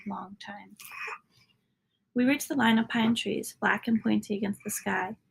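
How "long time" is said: whisper. A narrator's reading. Child narrator longing, trailing softly.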